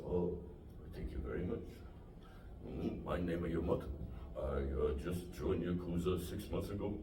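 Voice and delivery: put-on low, soft Japanese-accented chef; quiet and polite, a Japanese accent; sushi chef impression. Sushi chef answering about his fingers in a low Japanese accent. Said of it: "I have an impression of a guy that I met".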